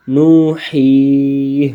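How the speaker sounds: نو، ہی، drawn out slowly in Quran recitation style; waqf stop reading. Shown as a demonstration. Teacher shows pupils the waqf reading, slow and drawn. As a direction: stretch نو، ہی، slowly, teacher demonstrating a pausal reading. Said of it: "وقفاً".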